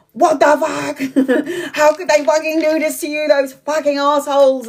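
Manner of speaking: high-pitched sweary indignation, broken by a laugh; bitchy outrage. Retold or imitated. Ranting on behalf of a friend, bitchy outrage. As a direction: act out your outraged self, half laughing. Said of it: "with her bitchy self going".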